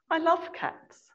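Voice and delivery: high, light cooing; sweet witch queen. A narrator's reading. Queen answers Scrub about cats, sweetly.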